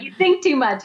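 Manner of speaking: scolding her gently in a Balinese healer's broken English; imitating broken English. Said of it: "in his beautiful broken English".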